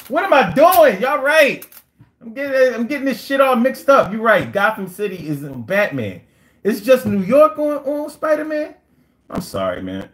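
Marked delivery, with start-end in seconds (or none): 0.1-8.7 flustered excitement. Real, not acted. Flustered at mixing up superheroes.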